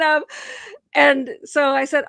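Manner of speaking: ordinary delivery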